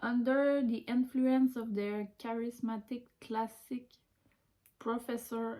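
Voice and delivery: reads the English slowly, word by word; slow careful English reading. Shown as a demonstration. Reading the English blurb to viewers, slow and careful. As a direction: sound out each English word with care.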